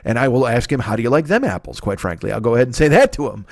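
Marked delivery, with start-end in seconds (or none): none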